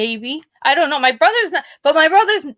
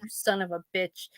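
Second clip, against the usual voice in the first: much softer, a muttered curse; grumbling brother; 6 semitones lower, 13 dB quieter than usual. Retold or imitated. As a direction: grumble it under your breath. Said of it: "He'll be like".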